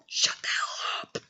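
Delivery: unvoiced, whispered mock telling-off; mock hushed retort. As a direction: whisper it as a playful rebuke. Mock aside to teasing audience.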